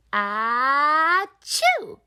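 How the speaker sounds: a big piglet sneeze, high and loud. Acted. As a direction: sneeze it out with force. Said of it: "replies George".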